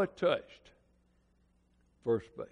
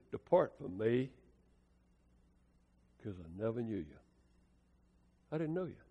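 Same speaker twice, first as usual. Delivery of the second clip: saddest words; 5 semitones lower, 9 dB quieter than usual. Retold or imitated. The Lord rejects the sinner, low soft sorrow. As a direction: let it fall heavy and sad. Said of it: "the saddest words in the Bible".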